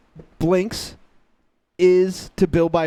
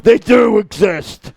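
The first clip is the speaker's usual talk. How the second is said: mock indignant shout; 3 semitones higher, 8 dB louder, slower than usual. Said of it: "and you were like".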